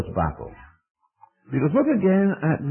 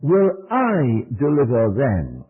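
"I" punched, the line slow and a bit louder; slow emphatic "Will I deliver them" with "I" hammered; stress on I; 4 dB louder, slower than usual. Shown as a demonstration. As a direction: hit the word "I" hard and drag the line. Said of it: "the emphasis is on the "I".

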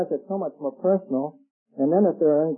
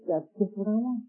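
lightly voiced boy, higher pitched; higher-pitched retold thought of a boy; kid's wistful thought; 8 semitones higher than usual. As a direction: think it aloud in a small high voice. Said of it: "And he thought".

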